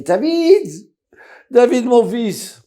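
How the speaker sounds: high, loud, slow calls to his son; crying out in sleep. Acted. Father screams for his son in a nightmare. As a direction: cry out from a nightmare. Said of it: "il commence à crier".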